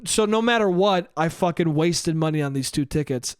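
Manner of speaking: usual speaking voice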